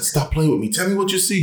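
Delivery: high, pleading reenactment; replayed high-pitched worry; retold anxious demand. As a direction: act out panicky insistence, climbing in pitch.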